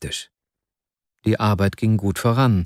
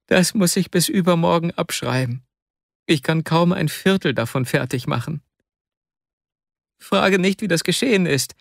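put-on higher, anguished young man confessing; Wasja in anguish; 7 semitones higher than usual. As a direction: plead guilty with a strained high voice.

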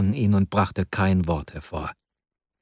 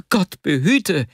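higher, alarmed outcry; cry of alarm; 8 semitones higher, slower than usual. A narrator's reading. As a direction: blurt it out in fright. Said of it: "schrie Wasja auf".

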